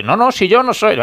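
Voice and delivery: high-pitched retold protest of innocence; mock denial. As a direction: imitate a player insisting it wasn't him. Mimics player denying he protested.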